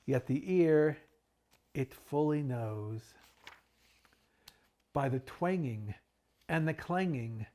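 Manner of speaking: dramatic poetry reading, rising with the bells; theatrical reading of a Victorian poem; poem recital. Acted. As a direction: read it like a spooky Poe recital, building to a frenzy. Reads Poe's The Bells aloud.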